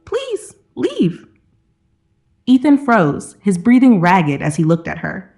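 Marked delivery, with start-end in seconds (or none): 0.1-1.2 high, soft plea; pleading. A narrator's reading. Lila begging Ethan to leave, pleading. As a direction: beg in a high, small voice. Woman begs man to leave.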